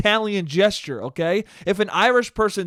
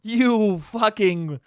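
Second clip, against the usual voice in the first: slow, drawn-out, higher comic curse; mock fury; 4 semitones higher, much slower than usual. Podcaster cursing Apple for the emoji, mock furious. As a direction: stretch the insult out in exaggerated exasperation.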